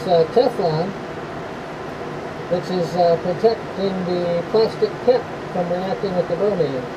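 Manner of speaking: muffled, speaking through a respirator mask. Real, not acted. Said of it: "I'm all geared up in the respirator".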